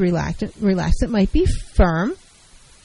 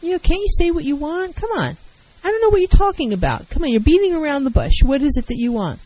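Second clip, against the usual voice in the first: much higher and fast, impatient, badgering; aggressive; 6 semitones higher than usual. Shown as a demonstration. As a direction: snap at a partner who won't say it. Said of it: "You don't have to do all this whining".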